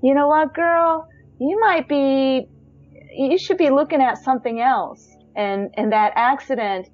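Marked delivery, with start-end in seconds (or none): 0.0-5.1 higher, knowing, sassy nudge; the universe gently setting a girl straight. Pretend. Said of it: "the universe telling me".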